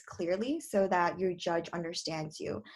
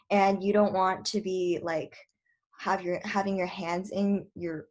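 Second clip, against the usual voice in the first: ordinary talk, nothing marked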